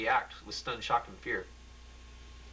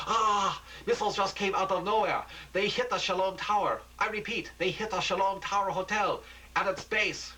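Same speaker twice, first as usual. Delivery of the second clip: alarmed report; 9 semitones higher than usual. Acted. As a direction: shout the news in horror. Reporter sees missiles hit hotel.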